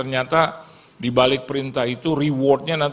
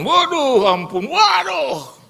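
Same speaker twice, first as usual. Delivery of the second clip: much higher, louder, slow wail; mock wail; 5 dB louder, much slower than usual. Preacher recalls losing money, slow high loud wail. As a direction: moan over the lost money.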